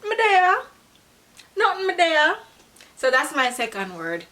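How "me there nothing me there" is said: high, light, laid-back patois answer; casual Jamaican reply. Shown as a demonstration. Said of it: "their reply normally is".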